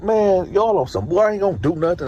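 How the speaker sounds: retold complaint, pitched well up; indignant protest. Inmate being handcuffed by guards, indignant. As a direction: protest your innocence in a high voice.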